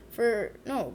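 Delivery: usual speaking voice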